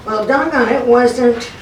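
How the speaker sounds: normal talking voice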